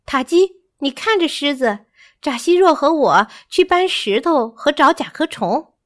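high, urgent monkey voice; excited little monkey giving orders; bossy little monkey. Acted.

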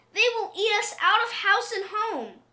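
put-on high-pitched old woman's warning; old woman protesting. A narrator's reading. Old woman warns husband about hungry cats, high protest. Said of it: "said the old woman".

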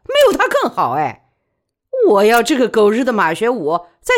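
through gritted teeth, loud and high; bitter fury. A narrator's reading. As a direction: spit out the resentment with defiant force. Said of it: "李宝莉说的咬牙切齿".

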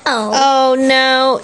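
high, held storybook cry; mild mock shout for Zig; Zig's shout. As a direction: cry out high, without real volume. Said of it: "shouted Zig".

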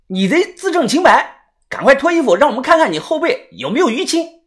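high-pitched insistent urging; excited, high accusing voice; loud demand. A narrator's reading. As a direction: press him eagerly in a high voice. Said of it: "豆芽仔立即大声地说".

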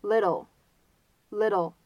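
slow "little" with the middle between T and D; American flap T. Shown as a demonstration. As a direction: model "little" as a light "liddle". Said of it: "这个发音呢是介乎于t跟的".

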